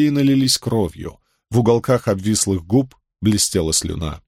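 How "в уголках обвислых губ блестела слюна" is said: plain reading voice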